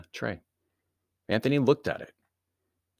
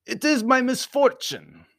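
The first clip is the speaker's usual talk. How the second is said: high, slow, louder courtesy; polite Baron; 8 dB louder than usual. A narrator's reading. Baron exchanging courtesies with his host, high and polite. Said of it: "he added politely".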